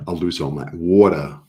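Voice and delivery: New York accent on "water". Shown as a demonstration. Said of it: "with that New York accent".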